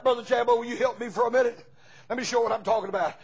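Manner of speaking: normal talking voice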